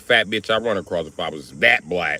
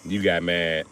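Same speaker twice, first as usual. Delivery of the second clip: ordinary talk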